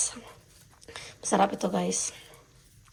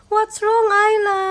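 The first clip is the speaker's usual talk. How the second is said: higher coaxing; 11 semitones higher, 6 dB louder, slower than usual. Real, not acted. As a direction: coax her a touch louder. Asking baby again, higher.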